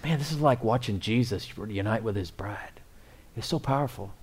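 hushed, breathy whisper of awe; awed whisper. Retold or imitated. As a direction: whisper it as if watching something sacred. Retold awe at daughter's wedding.

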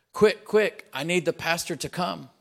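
desperate man shouting for the pastor; urgent plea. Acted. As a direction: gasp it out like a frantic rider. Rider gallops up urgently.

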